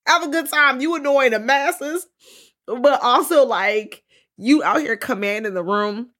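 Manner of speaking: put-on high, loud hype-girl cheer; shouting encouragement to Sookie. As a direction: cheer her on like a proud bestie. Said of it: "I'm like".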